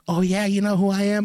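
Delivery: high mocking imitation of a challenging kid; cocky kid. Kid walks up to challenge the man, cocky mimicry. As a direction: mimic a kid talking big.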